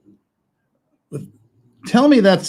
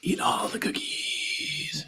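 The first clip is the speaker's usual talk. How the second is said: whispering; 7 semitones higher, 9 dB quieter, slower than usual. Pretend. Whispered temptation to child.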